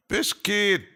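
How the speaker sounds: high, loud call to a pet. Real, not acted. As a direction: sing out the name to summon a pet. Calling the pet Biscuit.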